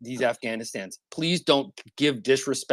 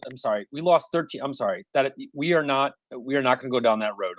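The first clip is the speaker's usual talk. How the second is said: normal talking voice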